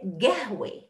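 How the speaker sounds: قهوة said gahwe with a hard g; teacher imitating the Bedouin form. Shown as a demonstration. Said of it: "the Bedouin will say".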